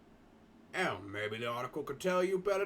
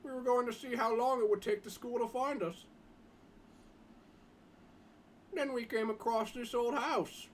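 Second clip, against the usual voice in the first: schoolboy Jim; 8 semitones higher than usual. Acted. Boy Jim telling the others about his school, higher boyish voice. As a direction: lift the voice for a polite teenage schoolboy.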